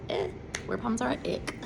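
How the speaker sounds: quick, soft, grossed-out mutter; disgusted aside. Acted. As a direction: wrinkle your nose at it. Disgusted aside about word problems.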